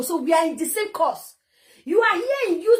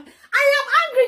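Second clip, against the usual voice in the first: raised, emphatic angry declaration; 7 semitones higher, 4 dB louder than usual. Real, not acted. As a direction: insist on your anger, pitched high. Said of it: "I said I'm angry".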